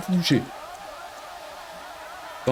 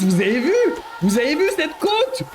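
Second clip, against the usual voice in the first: shocked disbelief; 9 semitones higher than usual. Real, not acted. Shocked at steep ski slope.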